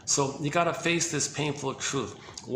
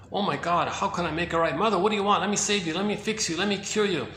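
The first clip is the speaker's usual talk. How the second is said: put-on higher, pleading guilty child; anxious inner child; 4 semitones higher than usual. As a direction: beg like a child desperate to fix things. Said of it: "he may think to himself".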